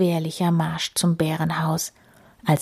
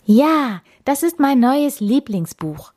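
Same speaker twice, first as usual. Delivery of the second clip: higher, pleased declaration; keen declaration; 6 semitones higher, 4 dB louder than usual. A narrator's reading. Fox declaring a new favourite book, keen and lifted. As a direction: announce it with happy emphasis. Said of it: "erklärte der Fuchs".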